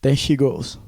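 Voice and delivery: normal talking voice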